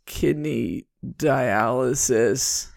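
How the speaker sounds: put-on slow, weak, groaning old lady; very old woman. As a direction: drag it out like a frail old patient. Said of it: "she kinda goes".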